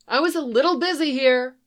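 annoyed woman brushing off help; pissed off. A narrator's reading. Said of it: "sounding more pissed off than grateful".

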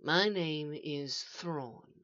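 very slow, hushed introduction; name yourself quietly, drawing it out; soft and measured. A narrator's reading. Mysterious figure introduces himself.

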